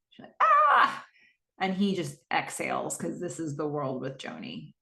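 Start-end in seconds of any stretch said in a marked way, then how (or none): 0.4-1.1 put-on high, loud cry of teen exasperation; imitated teenage shriek of frustration; mock frustrated scream. Acts out exasperated daughter storming off.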